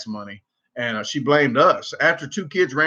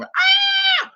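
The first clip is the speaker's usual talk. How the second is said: sudden shrill mock shriek, much higher; imitated scream; 3 dB louder than usual. Neighbour shrieking at each lightning flash, mock scream. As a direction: burst out with her scream at the lightning. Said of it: "she would scream".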